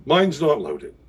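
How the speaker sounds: loud, high cheeky reassurance; cheeky pirate. Retold or imitated. Pirate teasing the nervous judge, cheeky and loud. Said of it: "I was like".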